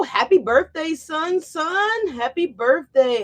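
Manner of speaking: high, loud and drawn out, celebrating; excited birthday cheer. Real, not acted. Host cheering a viewer's son's birthday, high and excited. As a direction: belt out a joyful happy birthday.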